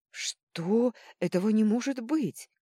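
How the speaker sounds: loud, high-pitched disbelief; astonished cry. A narrator's reading. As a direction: exclaim in shocked amazement. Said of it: "полный изумления вскрик мэтра Жужжина". (изумлённый возглас)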